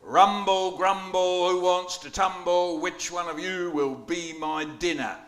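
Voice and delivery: put-on children hear the Cyclops demand his dinner; booming Cyclops. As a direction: roar it out like a greedy giant. Poet voices the hungry Cyclops.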